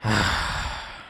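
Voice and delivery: long, voiceless sigh; weary sigh of giving up; defeated sigh. Real, not acted. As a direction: let out a big resigned exhale. Gives up on the rant.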